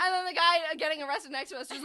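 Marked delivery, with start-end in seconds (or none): none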